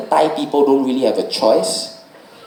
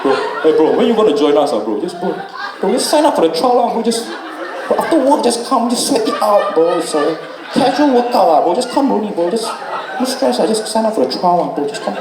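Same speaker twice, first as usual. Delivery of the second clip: much higher, laughing sleazy bro voice; pushy gym coach; 7 semitones higher than usual. Retold or imitated. Comic mocking the coach recruiting him. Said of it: "The voice damn disgusting".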